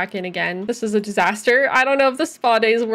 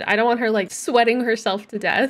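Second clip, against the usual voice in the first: ordinary talk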